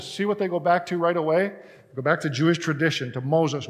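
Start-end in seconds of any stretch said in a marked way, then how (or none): none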